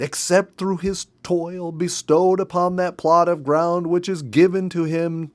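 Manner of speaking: wife reading aloud over honking porcupines, stubborn and loud. Acted. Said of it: "stubbornly continued".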